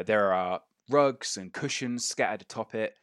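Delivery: ordinary talk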